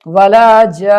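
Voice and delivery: long held vowel into doubled n; Quran recitation. Shown as a demonstration. Teacher reciting a phrase for learners, drawn-out recitation.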